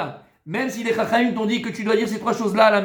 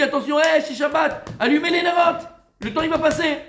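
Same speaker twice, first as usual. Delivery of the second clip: raised, high-pitched warning call; urgent order; 8 semitones higher than usual. Acted. Husband ordering his wife to light the candles, urgent.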